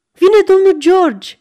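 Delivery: loud, high, drawn-out cry; strigăt. A narrator's reading. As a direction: call it out loudly to the whole house. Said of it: "strigă mătușa Chloe". (strigă tare și înalt)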